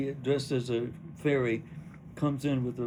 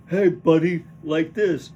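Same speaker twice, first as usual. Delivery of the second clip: loud American; 4 semitones higher, 12 dB louder, slower than usual. Acted. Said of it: "The American".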